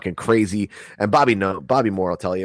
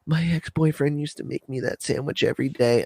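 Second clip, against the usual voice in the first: higher, softer mock weepy girl voice; a lightly faked tearful girlfriend; mimicking crying girlfriend; 6 semitones higher, 5 dB quieter than usual. Ex-girlfriend whining about her ex's sandwich, softer and higher. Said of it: "she goes".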